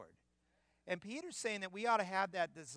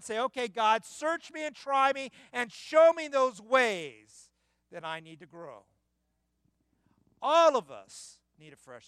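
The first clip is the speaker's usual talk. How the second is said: full-force preaching; 8 semitones higher, 13 dB louder than usual. Acted. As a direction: pound the point home from the pulpit.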